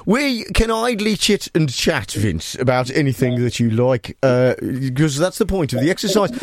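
put-on Brummie interviewer voice; Birmingham accent. Host interviews lunching caller, mock Birmingham accent. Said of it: "I'll do Birmingham".

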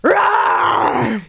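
big powerful shouted roar. Pretend. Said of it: "Well, you do it".

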